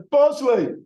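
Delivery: loud, high shout of "Bosley!"; loud, high call of a name across a room. Real, not acted. Calling out a name to crowd.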